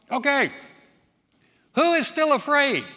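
high, loud barked question; officers calling out. Acted. Officer shouts to soldiers asking who fears, loud and high. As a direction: call out to the whole army.

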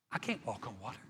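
hushed, low inner thought; dawning doubt. Acted. Preacher voicing Peter's thought on water, hushed doubt. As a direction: murmur it as a sinking realization. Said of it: "maybe the thought began to hit Peter".

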